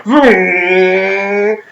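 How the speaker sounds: long, held vroom imitation; childlike imitated motor sound; toy engine noise. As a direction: play at flying a toy, stretching out the buzz. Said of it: "running around ... going".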